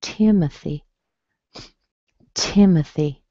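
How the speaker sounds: "Timothy" as TIM-uh-thee; weak uh and short final ee in "Timothy"; schwa, little E. Shown as a demonstration. Teacher showing the Y, little E ending "Timothy". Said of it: "we have a little E sound".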